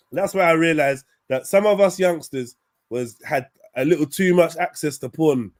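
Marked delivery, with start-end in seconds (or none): none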